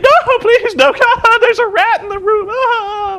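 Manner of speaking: high falsetto damsel in hammed-up terror; mock girly panic. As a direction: squeal helplessly in exaggerated girly fright. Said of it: "running around the ring like a girl".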